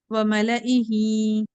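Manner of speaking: slow, drawn-out tajweed recitation; Quran recitation. Shown as a demonstration. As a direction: chant the verse slowly with care. Teacher recites word. (धीमी तजवीद वाली तिलावत)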